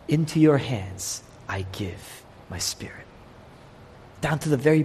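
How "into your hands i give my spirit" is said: unvoiced last breath, almost no sound; whispered last words. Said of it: "the last thing he says is".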